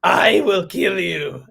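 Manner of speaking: slow, mock menacing reading; hammed-up mom, slow and mock threatening; mock mom threat. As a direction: drawl it as a fake threat. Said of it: "She said".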